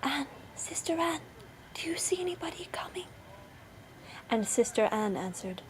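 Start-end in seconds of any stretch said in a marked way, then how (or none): 0.0-3.1 high, breath-light whispered call; very soft cry. A narrator's reading. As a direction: call softly so the husband won't hear. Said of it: "she cried out very softly".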